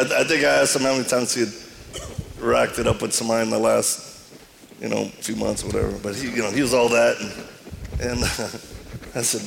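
ordinary talk, nothing marked

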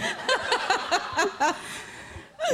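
high-pitched burst of laughter. Real, not acted. Telling the host about her husband, high laugh. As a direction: laugh high and long at the punchline.

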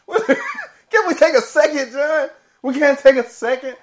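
high, loud, incredulous laughter; laughing protest. Real, not acted. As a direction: plead through helpless laughter. Laughing protest at cohost's haste.

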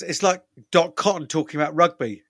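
ordinary talk, nothing marked

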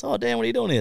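high, startled greeting; surprised coworker. Retold or imitated. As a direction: sound caught off guard. Operator voiced surprised.

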